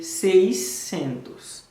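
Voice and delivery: "seiscentos" drawn out slowly, "say-SEN-toos". Shown as a demonstration. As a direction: model "seiscentos" slowly for the class. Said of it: "sis san". (di "seiscentos" dousman pou elèv yo)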